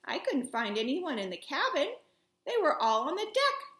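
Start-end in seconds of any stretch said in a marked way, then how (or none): none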